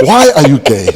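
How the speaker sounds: put-on loud laughing Ugandan accent. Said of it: "say it in a Ugandan accent".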